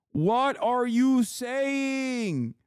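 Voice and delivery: high, drawn-out, incredulous repeat of the question; exasperated disbelief. Acted. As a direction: stretch it out, high and baffled.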